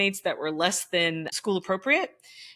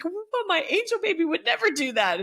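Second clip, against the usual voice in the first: much higher, fast, sing-song parent voice; mock doting parent; 12 semitones higher, faster than usual. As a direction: parody parents defending their angel.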